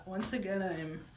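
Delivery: usual speaking voice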